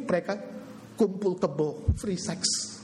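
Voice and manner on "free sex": ordinary talk, nothing marked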